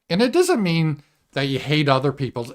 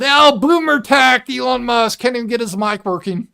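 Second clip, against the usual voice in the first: mocking crowd voice; 9 semitones higher, 5 dB louder than usual. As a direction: jeer at the billionaire like the crowd. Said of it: "So everyone".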